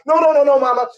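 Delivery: put-on a scared boy backing away; panicked refusal.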